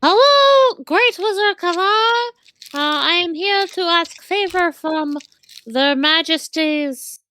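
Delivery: high squeaky rat voice; rat captain. Acted. Rat captain asking the wizard a favor, high squeaky. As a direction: speak like a polite little rat.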